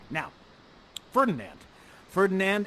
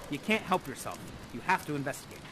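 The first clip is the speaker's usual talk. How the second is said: ordinary talk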